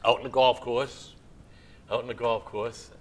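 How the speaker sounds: ordinary delivery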